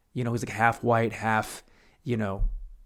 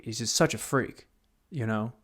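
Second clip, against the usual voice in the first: usual speaking voice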